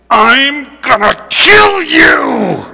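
slow, high, drawn-out mock wail; hammed-up vengeful howl; menacing threat. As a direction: imitate a furious brother, stretching every word. Imitates hurt brother threatening revenge.

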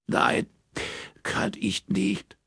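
high, slow tearful refusal; choked, despairing and high; close to tears. A narrator's reading. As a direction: refuse while breaking into tears. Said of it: "brach erneut in Tränen aus".

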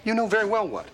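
high, loud, exasperated retort; indignant scolding. Acted. Answering his partner's feigned innocence about peeking, indignant.

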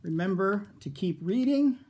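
ordinary delivery

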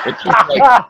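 loud, high, disbelieving cry; half-laughing shout of disbelief; exasperated outburst. Real, not acted. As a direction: throw up your hands at how dumb it is. Reacting to the absurd movie line.

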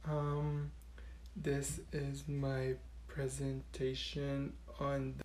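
barely audible shy whisper. Acted. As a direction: whisper it, too shy to speak up. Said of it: "why is she whispering?".

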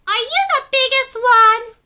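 high, loud curious little penguin voice; a tiny penguin full of questions. Acted. Story reader voicing small penguin Pipkin asking big questions. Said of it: "asked Pipkin".

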